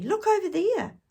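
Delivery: high, loud, urgent pointing; excited pointing. Acted. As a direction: call attention, thrilled.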